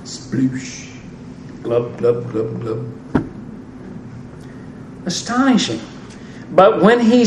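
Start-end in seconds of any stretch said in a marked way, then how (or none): 0.1-0.9 whispered splash noise of a man dropping into water; unvoiced sploosh of someone sinking; splash sound. Shown as a demonstration. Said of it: "How about you?". 1.6-2.8 low, soft glub glub of someone going under; mock drowning bubbles, quiet and low; sinking gurgle. Shown as a demonstration. Sinking noise after splash.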